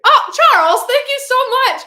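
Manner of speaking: high, loud burst of gratitude; excited thanks. Real, not acted. Streamer thanks Charles for his gift, excited and loud. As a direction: break off and exclaim thanks.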